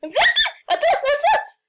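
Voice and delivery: high, loud laughing yell; laughing shout. Real, not acted. Panicked game chase, laughing shout.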